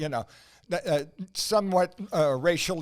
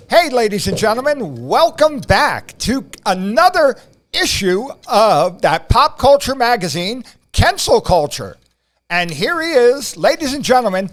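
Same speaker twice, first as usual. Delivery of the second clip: game show announcer; 7 semitones higher, 13 dB louder than usual. Acted. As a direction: hype the crowd like a game show host. Hypes up the podcast intro.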